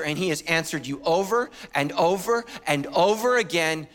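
ordinary talk, nothing marked

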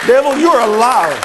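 loud, firm rebuke of the devil; forceful loud rebuke. Retold or imitated. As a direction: call the devil a liar to his face. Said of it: "and you say".

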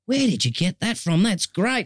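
high-pitched retold amazement; delighted surprise. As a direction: react like you just heard a gem. Retelling surfer's delighted reply.

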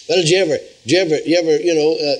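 normal talking voice

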